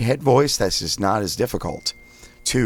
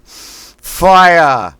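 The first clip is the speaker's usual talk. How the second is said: Boston accent; 10 semitones higher, 12 dB louder than usual. Shown as a demonstration. Coach demonstrating Boston vowel drill, loud thrust-out Boston Fire. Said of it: "We say fire like a Boston accent".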